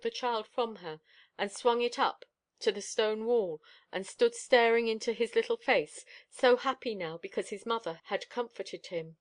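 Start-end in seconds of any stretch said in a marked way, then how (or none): none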